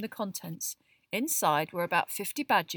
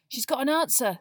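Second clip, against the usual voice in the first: louder, higher, excited announcement; excited Ron; 6 semitones higher, 7 dB louder than usual. A narrator's reading. Boy spotting parchment on the owl's leg, excited. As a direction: blurt it out excitedly. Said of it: "said Ron excitedly".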